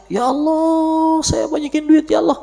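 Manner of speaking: mock-whining, high-pitched prayer; mock pleading prayer. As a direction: beg for money like an impatient believer. Mocking an impatient money prayer.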